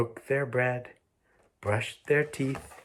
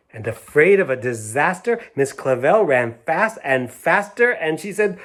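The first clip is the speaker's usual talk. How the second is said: urgent narration; 5 semitones higher, 8 dB louder than usual. A narrator's reading.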